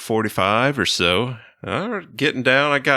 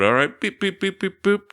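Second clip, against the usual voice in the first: imitated keypad beeps; 9 semitones higher than usual. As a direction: tap out the tones with your voice.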